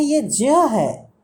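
slow ज्ञ as ज joined with य; correct pronunciation. Shown as a demonstration. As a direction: say ज्ञ slowly as ज्य. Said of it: "इसका correct pronunciation ज्ञ है". (ज्ञ को ज और य मिलाकर धीरे बोलें)

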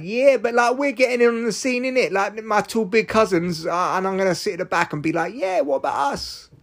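put-on high-pitched street-accent hanger-on; put-on street accent, pitched right up; Iran. Iran wanting in on the scene, high silly street accent. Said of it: "this is me using silly voices".

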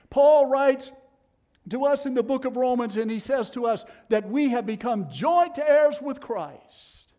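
loud, rising preacher's cry; full-force preaching. Acted. Preacher thundering to the congregation, loud and rising. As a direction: build it to a ringing peak.

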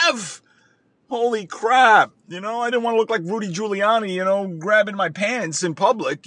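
usual speaking voice

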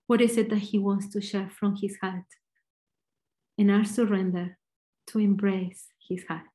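normal talking voice

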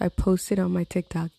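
usual speaking voice

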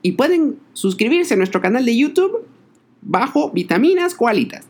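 squeaky cartoon koala voice; chirpy mascot sign-off; Cookie Koala voice.

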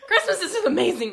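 loud and high, laughing; laughing shout. Real, not acted. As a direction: shout it back, giggling.

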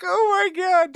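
high, loud mock-shocked outcry; retold disbelief. Comic retelling his shock at a coworker's confession, hammed-up disbelief.

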